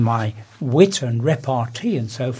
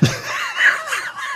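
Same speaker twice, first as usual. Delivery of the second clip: big laugh. Real, not acted. Laughs at own punchline.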